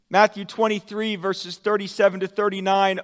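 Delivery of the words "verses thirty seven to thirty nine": ordinary talk, nothing marked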